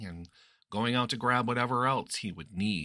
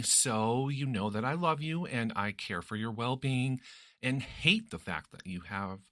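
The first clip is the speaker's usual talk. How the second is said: ordinary delivery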